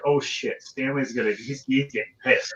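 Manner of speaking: whisper-worried like crew on set; hushed crew worry. Retold or imitated. Crew members fearing the director's anger, hushed worry. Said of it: "people were like".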